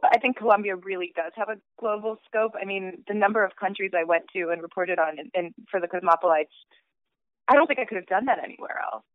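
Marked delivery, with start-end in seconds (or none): none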